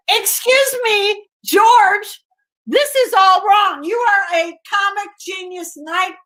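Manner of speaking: telling George off in a high, mock-indignant voice; high excited protest. Retelling her own protest to George.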